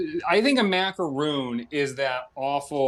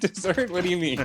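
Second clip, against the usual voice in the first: high-pitched, affronted disbelief; taken aback; 8 semitones higher than usual. Real, not acted. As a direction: react as if slapped in the face. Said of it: "you acted like I slapped you in the face".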